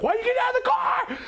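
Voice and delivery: high, fast panicked yell; startled cousin shouting at the one climbing out. Retold or imitated. Cousin panics as she exits.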